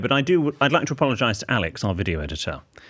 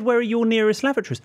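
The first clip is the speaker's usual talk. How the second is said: mocking shop assistant; 11 semitones higher than usual. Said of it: "She said".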